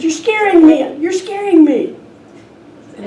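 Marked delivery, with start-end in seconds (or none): 0.0-2.0 put-on high, loud panicked child; frightened cry. Lost boy crying out to the echo, loud and terrified. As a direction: beg the voice to stop, terrified. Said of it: "He said".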